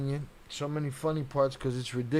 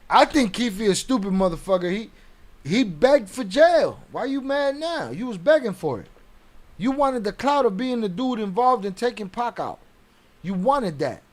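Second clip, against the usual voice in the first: loud, high, scornful rant; exasperated shouting at the accused; angry rant; 7 semitones higher, 6 dB louder than usual. Real, not acted. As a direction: tear into him like he's right there. Ranting at Keffe D on stream.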